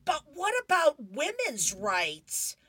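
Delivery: high, loud, slow exasperated protest; a frustrated girl shouting to her friend. Acted. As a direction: arms flung out, fed up with her friend. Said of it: "Peppermint Patty is all frustrated".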